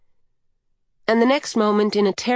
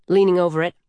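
plain reading voice

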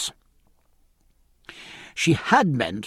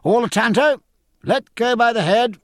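called-out nautical orders, higher and louder by the end; calling out; 7 semitones higher, 4 dB louder than usual. Acted. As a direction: call instructions across to a gardener, building in pitch. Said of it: "he called to the gardener".

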